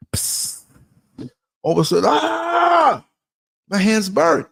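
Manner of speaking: snatch the hand back with a sharp cry; a hammed-up yelp of pain; mock burn yelp. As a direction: hiss then sudden high yelp. Acting burnt hand on stove.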